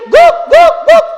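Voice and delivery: three loud, high mock barks; imitated dog barking. Dog reports the goat is dead, loud imitated barking. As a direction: yelp out three short woofs. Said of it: "dia teriak lagi".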